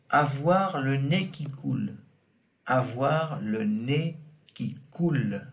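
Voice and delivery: ordinary talk, nothing marked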